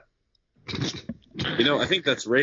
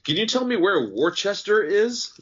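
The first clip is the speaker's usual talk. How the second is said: clueless tourist; 9 semitones higher, 4 dB louder than usual. Retold or imitated. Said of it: "you can always tell the tourists".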